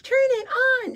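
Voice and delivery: put-on high childish shriek; whiny little kid. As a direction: demand it like a toddler. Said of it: "that battle of".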